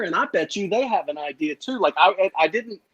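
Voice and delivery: normal talking voice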